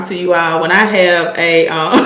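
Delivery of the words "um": ordinary delivery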